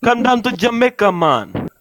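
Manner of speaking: loud, high hammed-up Jamaican accent; mock Jamaican accent. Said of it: "wrong African".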